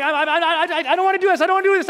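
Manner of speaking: acted-out nervous babble; panicked pleading. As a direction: blurt it out in a comic panic. Acting own panic before the jump.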